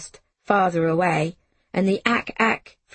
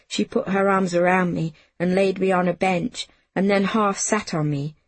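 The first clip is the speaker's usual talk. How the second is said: ordinary delivery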